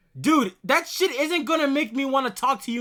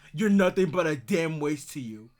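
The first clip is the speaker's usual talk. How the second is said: sneering parody in a much lower voice; mocking lower voice; 7 semitones lower, 4 dB quieter than usual. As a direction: throw "You're nothing but a damn waste to you" back at him in a lower, scornful voice. Mocks his line back at him.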